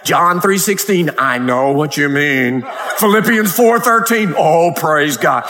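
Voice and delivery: slow, drawn-out parody of pious chat; mock pious Christians. Preacher parodies believers quoting verses to each other, mock pious. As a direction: trade verses with hammed-up solemn piety. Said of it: "They just quote Bible verses to each other".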